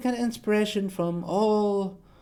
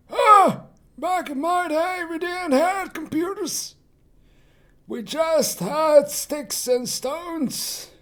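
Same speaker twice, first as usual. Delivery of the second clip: put-on high-pitched creaky old-timer voice; very old man; 11 semitones higher, slower than usual. Shown as a demonstration. Showing off old voices to viewers, very old man. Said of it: "we can go even older".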